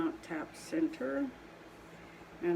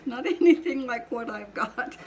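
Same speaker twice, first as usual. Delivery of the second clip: real high, loud laugh spilling into the words; 7 semitones higher, 7 dB louder than usual. Real, not acted. Potter joking about her own southern accent, laughing. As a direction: laugh through it, louder and higher.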